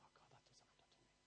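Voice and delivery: breathy whispered aside; whispered psalmist's confession. Acted. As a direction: breathe it like a private prayer. Psalmist's inner words, whispered.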